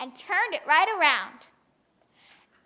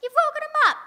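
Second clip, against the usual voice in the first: much higher, playful chiding; higher, lightly voiced mother's scold; mock scolding; 13 semitones higher, 7 dB louder, much faster than usual. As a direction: fuss at the wakened baby. Una scolding baby noise.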